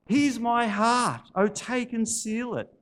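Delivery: high, loud, slow proclamation; urgent quoting. Acted. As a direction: declare the verse back with fervour. Woman quotes his hymn back urgently.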